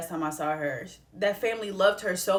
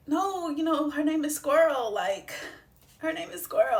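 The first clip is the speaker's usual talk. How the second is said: higher, pleading, voice close to breaking; tearful protest; 7 semitones higher than usual. Retold or imitated. Retelling to viewers how she pleaded for the dog's name. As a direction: insist through tears that the name stays. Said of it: "I lost it".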